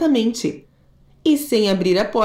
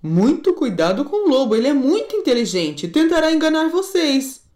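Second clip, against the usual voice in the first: lightly raised mother voice; a hint of a worried mother; mother goat; 4 semitones higher than usual. Mother goat warning kids about the wolf, lightly voiced.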